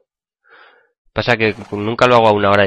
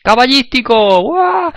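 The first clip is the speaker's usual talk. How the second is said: much higher cartoon exclamation; squeaky excited voice; 12 semitones higher, 3 dB louder, much slower than usual. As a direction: cheer it out in a chipmunk squeak. (voz aguda exclamando con entusiasmo)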